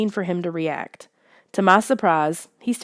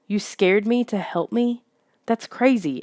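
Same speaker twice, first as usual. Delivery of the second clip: ordinary delivery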